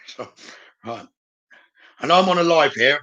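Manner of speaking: usual speaking voice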